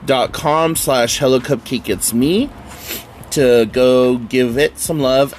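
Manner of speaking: ordinary talk, nothing marked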